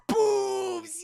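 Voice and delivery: high mock squeal; squealed cheer. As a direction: squeal it with glee.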